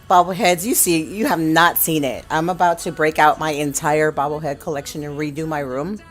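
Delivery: ordinary talk, nothing marked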